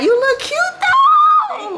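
high loud hype compliment; loud cheering praise; excited shout. Real, not acted. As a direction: shout the compliment across to her. Hyping stressed twin up.

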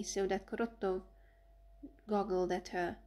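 plain reading voice

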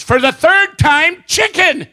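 slow, high, loud exasperation; exasperated wife. Acted. Wife turns round to deaf husband, loud slow exasperation. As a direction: spell it out loudly, fed up. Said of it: "She turns around and says".

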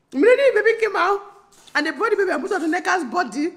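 high and fast, tumbling words; breathless excitement. Real, not acted. Recounting the birth to viewers, high fast excitement. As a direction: rush it out, thrilled.

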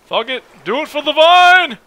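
high, loud reckless cheer; daring yell. Real, not acted. About to jump in, a high loud daring cheer. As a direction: hype yourself up to jump.

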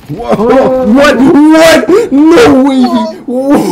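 high, loud stunned yells; excited shouting. Real, not acted. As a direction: shout in total disbelief. Stunned by a play.

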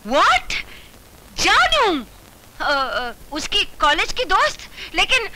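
high, loud, stunned outburst; shocked. Acted. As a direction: react in sheer disbelief. Wife shocked by girl calling.

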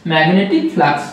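ordinary talk, nothing marked

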